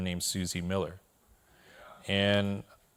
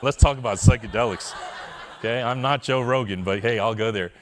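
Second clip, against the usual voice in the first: loud, laughing through the words; real amused laughter while talking; laughing aside; 7 dB louder than usual. Real, not acted. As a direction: share the joke with the room. Laughing crowd-pleasing aside.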